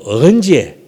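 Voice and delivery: Gyeongsang denial with rise-and-fall lilt; Gyeongsang dialect. Shown as a demonstration. Preacher adds another dialect way of denying, Gyeongsang accent. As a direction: punch it out in Gyeongsang intonation. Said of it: "이게 경상도 말이에요".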